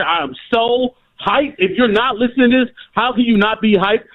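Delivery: a caller too hyped to contain himself, rising to a yelled slogan; emotional hype. Real, not acted. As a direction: lose it with excitement about the team, cheering. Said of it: "I'm sorry, I'm emotional".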